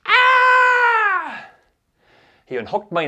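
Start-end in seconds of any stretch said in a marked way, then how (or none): 0.1-1.5 long, very high, loud scream; horrific scream. Retold or imitated. Bully squeezed by the balls, horrific high scream. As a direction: shriek as if in agony. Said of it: "His scream was horrific".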